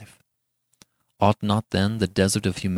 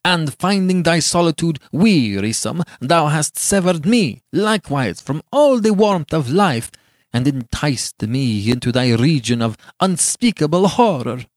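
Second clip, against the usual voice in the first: angry, raised accusation; venomous scorn; 6 semitones higher, 4 dB louder than usual. A narrator's reading. Giovanni blaming Beatrice for his doom, venomously angry. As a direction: accuse her with scorn, hammering the key words. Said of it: "with venomous scorn and anger".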